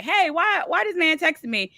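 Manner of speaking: high, startled "why this man texting me"; mock alarmed. She reads a text from her friend's man, startled and high. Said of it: "the first thing I was about to do was like".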